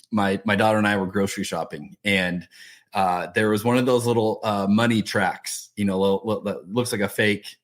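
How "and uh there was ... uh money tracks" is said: ordinary delivery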